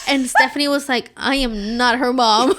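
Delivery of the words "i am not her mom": loud, slow, emphatic imitated protest; mock indignant. Sister mistaken for the mother, mock indignant. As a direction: deliver each word as an offended denial, half laughing. Retold sister rejects being called mom.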